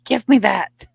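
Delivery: high, loud dog-character voice; imitated dog. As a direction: speak as a pet proudly introducing itself. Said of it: "She's like".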